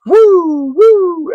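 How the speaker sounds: high whooping police-siren sound; siren imitation. Narrator mimicking blue-light sirens coming across the bridge, high siren whoop.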